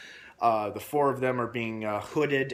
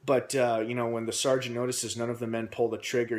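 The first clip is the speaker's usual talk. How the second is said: ordinary talk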